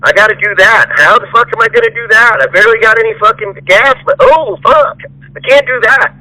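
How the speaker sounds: mock the lazy worker's fretting; a hammed-up imitation of a flustered employee; whiny employee mockery. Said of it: "And not".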